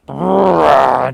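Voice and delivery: a big burst of laughter; loud laugh. Real, not acted. Laughing at the memory.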